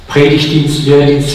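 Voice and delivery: ordinary talk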